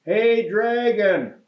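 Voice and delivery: high, slow, drawn-out shout; loud shout. Acted. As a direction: yell it slowly into the dragon's ear. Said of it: "shouted as loud as she could".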